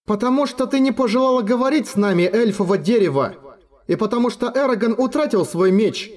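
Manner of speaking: high, fast snarling dragon. A narrator's reading. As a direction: answer back with bared teeth. Сапфира скалит зубы, отвечает. (высокий быстрый рычащий голос дракона)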